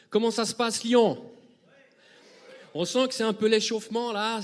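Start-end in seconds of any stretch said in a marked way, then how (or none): 0.1-1.2 louder hype call to the hall. Real, not acted. Performer warming up the Lyon crowd, loud call. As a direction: wake up the audience.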